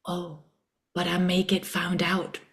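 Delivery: whispered, hushed, doubting; fearful little whisper. Retold or imitated. Her fearful inner voice whispering doubts, in a hushed whisper. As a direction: breathe it out like a scared inner voice. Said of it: "there's a little voice that's like".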